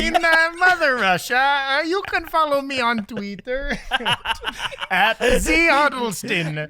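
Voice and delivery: slow, hammed-up Russian accent with a laugh; mock Russian accent. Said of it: "That's a spicy Russian".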